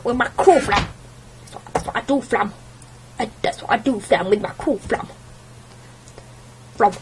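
playful mock-tough brag; silly boast. Threatening viewers jokingly, a silly mock brag. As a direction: show off the cool flam.

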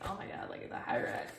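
normal talking voice

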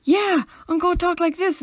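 high, loud put-on YouTube accent; copied YouTuber accent. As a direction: burst out in somebody else's accent. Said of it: "I've copied people's accents without realising".

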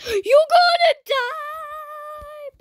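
drawn-out high dramatic cry; dramatic yell. Pretend. Calling out to the other player in the game, a slow dramatic wail. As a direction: wail it slowly like doom is coming.

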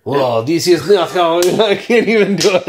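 put-on a half-hearted stab at a French accent; a playful, self-conscious French accent, given on request; French accent attempt. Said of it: "Give me a French accent".

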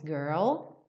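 ordinary talk, nothing marked

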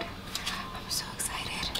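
excited whisper, slow and breathless. Real, not acted. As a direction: whisper it with giddy delight. Whispered aside over new palette.

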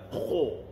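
kho with rounded lips, drawn out. Shown as a demonstration. Teacher shows the mistake, lip-rounded kho. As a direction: push the lips into a long kho. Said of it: "kita dah menggunakan bibir kita".

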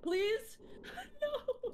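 high frantic laughing plea; panicked pleading. Real, not acted. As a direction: beg in a panic, half laughing.

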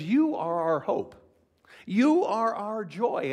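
loud, high and slow; ringing slow proclamation; Paul's fervent declaration. Acted. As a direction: proclaim it with delight. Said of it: "He says".

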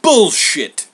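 loud, high dismissal; sharp retort. Acted. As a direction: burst out in disbelief. Homer rejects the request.

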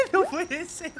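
very high laugh into the words; high laughter. Real, not acted. Streamer giggling that it can't be true. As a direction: laugh in disbelief.

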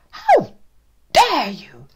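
loud high how dare you; outraged. Acted. Dad outraged at the teacher, loud. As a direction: hurl it in outrage.

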